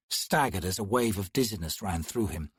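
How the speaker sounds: ordinary delivery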